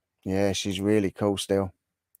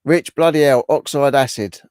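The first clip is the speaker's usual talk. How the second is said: louder, higher, amazed; surprised exclaim; 4 semitones higher, 7 dB louder than usual. Real, not acted. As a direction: react as if floored by the comment. Reacting to viewer comment.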